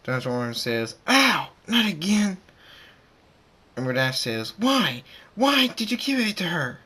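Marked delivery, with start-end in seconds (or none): 1.1-2.4 loud, high yelp of protest; pained outcry. A narrator's reading. Thunderstorm hurt once more, loud pained cry. 4.6-6.8 loud, high demanding outburst; upset confrontation. A narrator's reading. Dash confronting Thunderstorm over the amulet, upset outburst.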